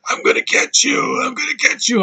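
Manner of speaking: strained, breathy threat rising high; hammed-up high, choked threat; mock wounded villain. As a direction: imitate a stabbed villain straining to shout. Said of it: "and he's like".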